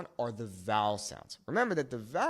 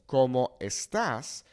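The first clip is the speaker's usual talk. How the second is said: estás as es-TAS, slow and loud; slow ¿Cómo estás? with estás stressed on TAS; accented final A; 5 dB louder, slower than usual. Shown as a demonstration. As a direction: punch the last syllable of estás. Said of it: "we have the accent here on this A".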